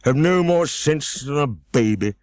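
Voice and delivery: high, slow, pompous egg voice; Humpty Dumpty voice. A narrator's reading. Narrator voicing Humpty Dumpty, high and pompous. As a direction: lift the pitch and lord it over her.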